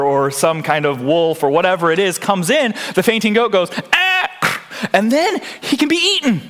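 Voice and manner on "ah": sudden high startled yelp; goat's scared cry. Retold or imitated. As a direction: cry out like a terrified goat. Fainting goat reacting to a predator.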